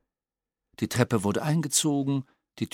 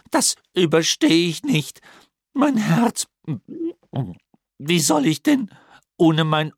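loud, higher pig voice, wailing reproach; sobbing pig reproach; 4 semitones higher, 6 dB louder than usual. A narrator's reading. Wutz blames the professor for losing Urmel, loud sobbing reproach. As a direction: scold the professor through sobs. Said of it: "Schweineschluchzen und Grunzen".

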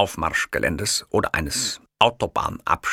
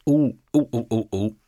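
sympathetic tutting; 4 semitones higher than usual. A narrator's reading. Kiosk man sympathetic noises.

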